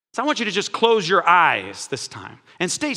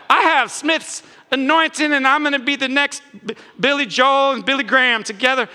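mock boastful believer; 6 semitones higher, 4 dB louder than usual. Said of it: "mocking ambitious believer".

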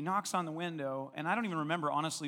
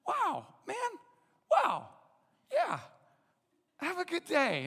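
higher, louder stunned wonder; lightly voiced awestruck man; amazed thanks; 14 semitones higher, 6 dB louder, slower than usual. Voicing the amazed healed man.

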